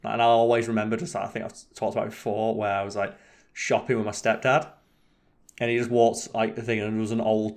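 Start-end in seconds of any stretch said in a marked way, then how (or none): none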